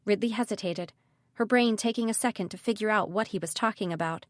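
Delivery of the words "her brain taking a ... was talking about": usual speaking voice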